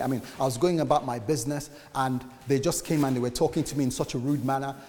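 ordinary talk

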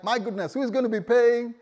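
high, loud panicky cry; hammed-up panic about the rent; mock panic. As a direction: disciples panicking over who pays the rent. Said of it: "I'm sure they were like".

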